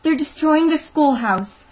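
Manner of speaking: high, loud, urgent plea; gasping alarm. A narrator's reading. Teacher runs to the sheriff for help, gasping in alarm. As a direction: raise the alarm in desperation. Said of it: "she said, gasping for breath".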